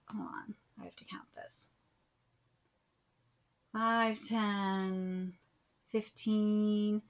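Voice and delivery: whispered while scanning the list; soft, whispered reading aside. Real, not acted. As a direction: mutter it under breath while reading.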